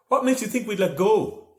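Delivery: high, loud retort; indignant shout. A narrator's reading. Joe to Aisling in the wind, loud indignant retort. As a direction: fire it back, offended. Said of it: "Joe replied".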